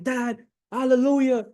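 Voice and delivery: high-pitched, overjoyed son; acted-out elated kid; crazy excitement. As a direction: burst out to Dad, thrilled and high. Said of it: "he's just going crazy of excitement".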